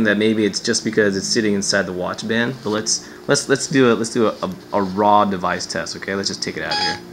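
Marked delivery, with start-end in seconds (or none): none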